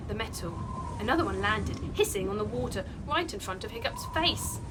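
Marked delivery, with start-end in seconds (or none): none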